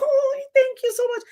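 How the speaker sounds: very high flattered thanks; flattered woman. Retold or imitated. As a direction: gush back, charmed. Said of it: "She was like".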